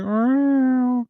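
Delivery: high, long plaintive meow; cat meow. Retold or imitated. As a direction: cry out like a lost cat. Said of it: "He's like".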